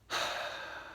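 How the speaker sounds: soft sigh after a pause; heavy sigh. Real, not acted. Teacher finishing a moving story, quiet sigh. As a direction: let out a quiet breath.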